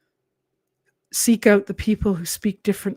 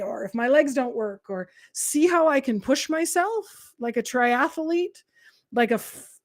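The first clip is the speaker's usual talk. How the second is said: proud inner voice; 4 semitones higher, 5 dB louder than usual. Retold or imitated. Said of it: "or do you say".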